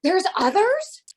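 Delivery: loud, high, drawn-out mock astonishment; floored. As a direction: imitate someone stunned by the news, slowly and high. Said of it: "he was floored".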